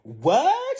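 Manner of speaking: high, loud squeak of disbelief; shocked what. Retold or imitated. Stranger shocked to hear they're engaged, high squeak.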